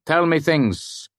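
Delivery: slow, high, pressing command; demanding. Acted. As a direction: drag it out, demanding facts. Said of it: "Elodine shouted without preamble".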